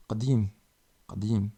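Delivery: "Qadim" with a deep back-throat q; teacher repeats "Qadim" loudly for learners; deep Arabic q. Shown as a demonstration. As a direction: click the q far back in "Qadim". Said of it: "Qa comme qahwa".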